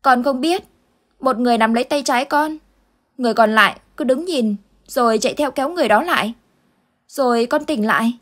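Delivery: put-on a scared kid trying to explain a nightmare; panicked child. Boy telling Uncle Tư his dream, panicked child voice. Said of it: "thái độ hết sức hoảng loạn".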